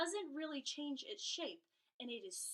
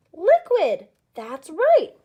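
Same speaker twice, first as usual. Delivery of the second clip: loud, high, cheerful praise; enthusiastic praise; 5 semitones higher, 23 dB louder, slower than usual. Acted. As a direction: cheer like a kids show host. Praising pretend answers from kids.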